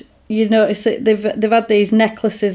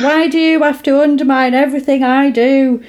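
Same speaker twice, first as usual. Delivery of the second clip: higher, whiny mock complaint; hurt accusation; 5 semitones higher than usual. Voicing Jennifer scolding her friend, a higher mock whine. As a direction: pitch up and whine it like a wounded diva. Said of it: "And then she's like".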